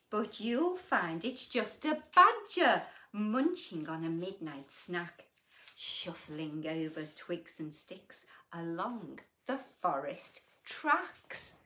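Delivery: lilting storybook read-aloud; reading to children. A narrator's reading. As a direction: read it like a bedtime picture book. Reading picture book aloud.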